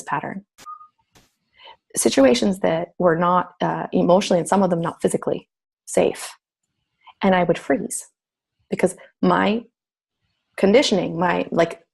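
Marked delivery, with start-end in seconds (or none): none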